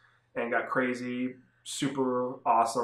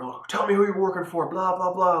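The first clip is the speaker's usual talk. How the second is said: higher-pitched, put-on interrogator's demand; mock interrogation; 6 semitones higher than usual. Scarecrow-like villain interrogating the Suicide Squad, mock interrogation. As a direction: imitate a frantic villain grilling captives. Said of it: "like, interrogating".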